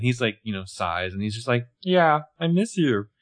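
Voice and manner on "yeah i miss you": slow, higher, lightly Muppet-like voice; drawn-out, raised, mildly puppet-like delivery; Muppetish voice. Retold or imitated. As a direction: put on a slightly Muppetish boyfriend. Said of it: "it's maybe more Muppetish".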